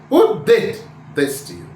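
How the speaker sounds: DID punched, the rest lighter; stress on DID. Shown as a demonstration. Teacher showing sentence stress, DID stressed. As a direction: lean hard on DID. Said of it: "any word you want to stress".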